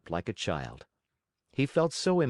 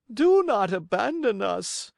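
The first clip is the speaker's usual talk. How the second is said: high, loud desperate plea; cling to him pleading; begging fox; 12 semitones higher, 6 dB louder than usual. Acted. Fox begs.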